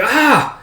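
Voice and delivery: short, high yelp of pain; acted pain cry. Fighter stabbed in the leg, high pained cry.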